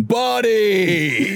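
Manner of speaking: high-pitched mock yell at a kid; startled dad's yell. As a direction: cry out high like an alarmed dad. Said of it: "remember his dad going".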